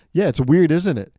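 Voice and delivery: mock indignant. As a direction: playfully throw it back at them, loud and high. Acted animal confronting humans.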